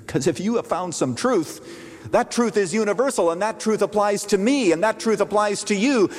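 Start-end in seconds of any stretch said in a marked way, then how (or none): none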